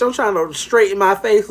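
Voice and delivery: ordinary talk, nothing marked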